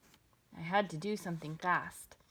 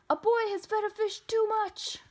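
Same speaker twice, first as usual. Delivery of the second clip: high, loud, held-up alarm call; urgent report; 13 semitones higher, 7 dB louder than usual. A narrator's reading. Policeman radioing the firemen, urgent high call. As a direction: announce the emergency at a high pitch.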